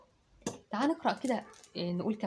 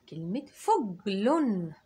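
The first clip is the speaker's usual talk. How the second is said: فجل drawn out very slowly; much slower than usual. Shown as a demonstration. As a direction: stretch the word out. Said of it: "كلمة ايه كمان". (كلمة فجل ببطء شديد)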